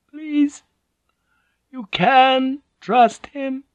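put-on high, slow, pleading old woman; dying old woman.

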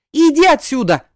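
loud, high, angry order; angry bark. A narrator's reading. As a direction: bark at him to clear out. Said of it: "рявкнула Ирада". (сердитый громкий окрик)

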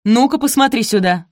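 loud, high furious order; angry shriek. A narrator's reading. Stepmother jabs at the scratched heel, shrieking. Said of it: "взвизгнула Араминта".

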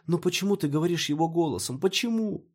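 high, anguished plea for an answer; distressed questioning. A narrator's reading. Maya questions Peter about his voice, distressed.

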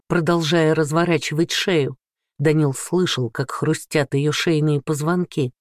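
ordinary delivery

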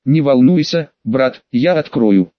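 ordinary delivery, nothing marked

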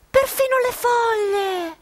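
slow, high, breaking dismay; dismayed lament. Acted. Narrators discovering the leaves are plastic, slow anguished lament. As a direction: drag it out as the fake world unravels.

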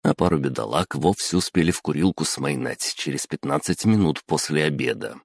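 usual speaking voice

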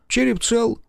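high, bright-pitched reassurance; optimistic. A narrator's reading. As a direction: announce it cheerfully. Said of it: "оптимистично сообщил он".

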